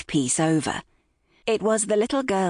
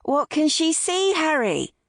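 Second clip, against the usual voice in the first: raised, much higher voice to carry; calling out; 11 semitones higher than usual. A narrator's reading. Mother calling to her son by the shore, calling out. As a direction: call it across the beach. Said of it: "Holly called out".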